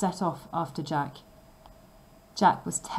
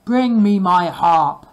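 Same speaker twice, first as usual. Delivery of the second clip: slow, much louder bellow; ogre's shout; 12 dB louder, slower than usual. A narrator's reading. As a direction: roar the order. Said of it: "the ogre shouted".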